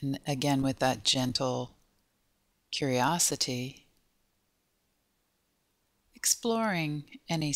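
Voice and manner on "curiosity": ordinary talk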